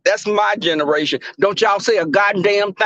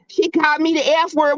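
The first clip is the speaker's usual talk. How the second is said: high-pitched imitation of outraged complaining; mock uproar; 8 semitones higher than usual. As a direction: mimic someone indignantly telling on her, pitched high.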